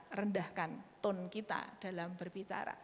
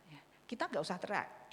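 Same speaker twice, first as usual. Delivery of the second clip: ordinary delivery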